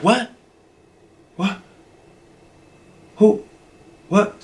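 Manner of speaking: short, baffled questions with gaps between them; mock confusion. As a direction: imitate her, lost and taken aback. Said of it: "now she's sounding all confused".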